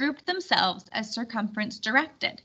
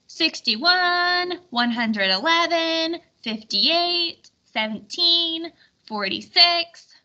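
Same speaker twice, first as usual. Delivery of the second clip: crowd calling out; 6 semitones higher, slower than usual. Acted. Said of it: "cried out voices in the crowd".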